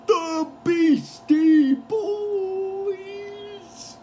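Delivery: slow, building ring-announcer call; concert announcer. Retold or imitated. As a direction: hype the band, stretching words into a long held finish. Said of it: "the announcer guy is like".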